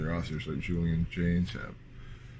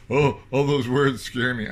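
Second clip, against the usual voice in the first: higher, louder, slow mock whimper; mock scared whine; 6 semitones higher, 8 dB louder, slower than usual. To people scared of words, a slow mocking whine. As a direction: mimic a reader spooked by too many words.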